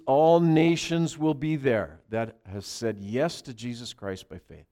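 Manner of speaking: normal talking voice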